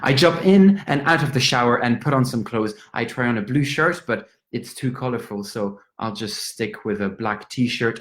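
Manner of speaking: ordinary talk, nothing marked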